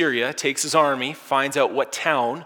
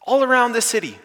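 panicking; 11 semitones higher, 8 dB louder than usual. Retold or imitated. Frightened servant begs Elisha for help, in a high loud panic. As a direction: cry out in panic, much higher and louder. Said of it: "And he's panicking".

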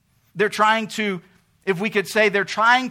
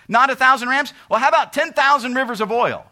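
mock bargaining Israel; 4 semitones higher, 6 dB louder than usual. Israel voiced bargaining with God.

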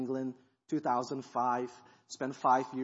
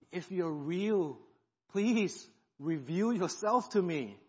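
slow, earnest plea to God; sincere prayer; slower than usual. Retold or imitated. Young man praying to God, slow and sincere. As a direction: pray it sincerely, slowly. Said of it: "as sincere as I could".

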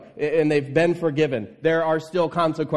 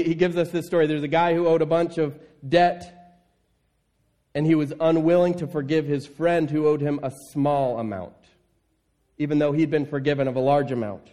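ordinary talk, nothing marked

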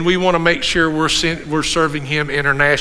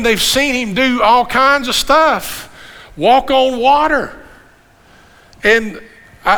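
higher, stretched-out preacher's exclamations; excited preaching; 5 semitones higher than usual. Acted.

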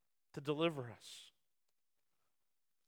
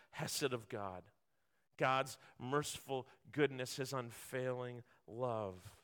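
soft, low and gentle; tender sermon voice; 8 semitones lower, 7 dB quieter than usual. Real, not acted. Preacher comforting the congregation, soft and tender. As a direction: speak of mercy softly.

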